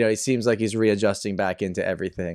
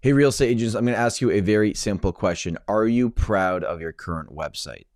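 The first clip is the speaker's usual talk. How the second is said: ad read; 3 semitones lower than usual. Acted. Pitching real estate agents a website, ad read. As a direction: direct sponsor pitch to the listener.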